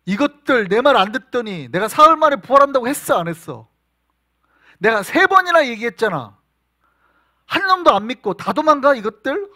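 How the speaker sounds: loud, high scolding rant; imagined scolding. Acted. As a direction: tear into the disciples who ran off. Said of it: "하고 혼낼 줄 알았어요". (도망간 제자들을 크고 높게 호통치는 예수)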